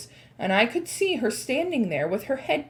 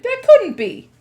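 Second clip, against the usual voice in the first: shouting; 14 semitones higher, 12 dB louder than usual. Acted. Witches disbelieve loudly.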